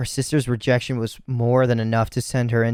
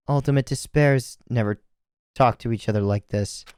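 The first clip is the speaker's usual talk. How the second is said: plain reading voice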